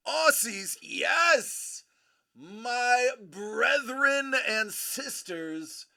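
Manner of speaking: loud, high, stretched-out salute; big jubilant shout-out; excited hailing. Real, not acted. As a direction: hail them like long-lost kin. Hailing Australian viewers.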